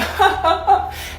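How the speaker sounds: imitating a guy's head-back chuckle; mock deep man laugh. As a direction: throw the head back and let out a short manly laugh. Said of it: "they be like".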